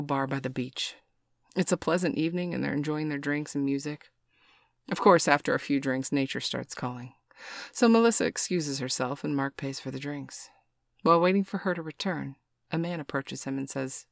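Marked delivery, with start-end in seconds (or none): none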